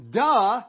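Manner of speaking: sarcastic loud "duh"; snarky duh. Acted. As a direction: blurt it out like an eye-roll. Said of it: "that's kind of her tone of voice".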